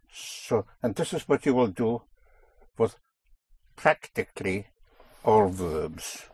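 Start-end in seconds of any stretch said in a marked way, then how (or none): none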